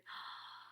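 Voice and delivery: quiet breathless gasp; soft gasp. A narrator's reading. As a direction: gasp in wonder. Mother recognizes baby.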